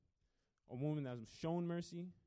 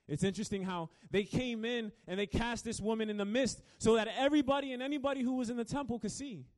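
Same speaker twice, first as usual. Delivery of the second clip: higher, louder preaching, pressing forward; preaching at full force; 8 semitones higher, 7 dB louder than usual. Acted. Preacher driving home the point to the congregation, fervent. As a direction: build the point with rising fervor.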